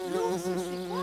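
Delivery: deep, loud growly bear. Pretend. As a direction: boom it out like a big bear. Introducing the bear animal.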